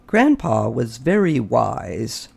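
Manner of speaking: ordinary delivery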